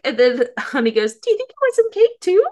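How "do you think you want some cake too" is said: put-on high, sweet, childlike voice; sweet little boy. Honey sweetly offers the frightened club some cake. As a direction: sound like an innocent little kid offering cake. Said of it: "the sweet little Honey face".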